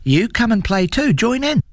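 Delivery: high, excited little lamb voice; playful lamb. A narrator's reading. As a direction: call a friend to join the game, pitched high. Lamb invites troll to play.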